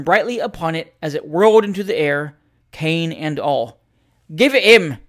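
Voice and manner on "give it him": loud, higher egging on; urging on. A narrator's reading. The waistcoat man loudly urges his friend into the fight. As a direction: spur your friend to hit him. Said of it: "said the waistcoat".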